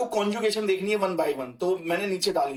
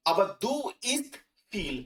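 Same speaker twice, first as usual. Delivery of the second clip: slow demo; 2 semitones higher, much slower than usual. Shown as a demonstration. Teacher models the heading's second half slowly and clearly. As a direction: model the sentence slowly for the class. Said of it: "heading क्या है?".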